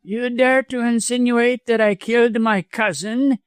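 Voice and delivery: high, strained voice, not truly whispered; high-pitched, shocked indignation; outraged stage whisper. A narrator's reading. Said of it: "he asked in a whisper".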